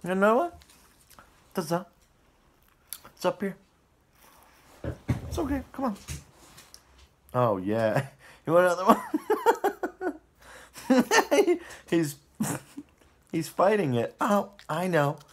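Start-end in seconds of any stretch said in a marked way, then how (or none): 0.0-6.0 high, soft coaxing pet voice; a sing-song voice for a nervous pet; talking to the dog. Real, not acted. Cooing to her dog. 8.5-11.6 rising high on one, with a laugh; laughing pet talk, ending on a squeak; offering the dog a treat. Real, not acted.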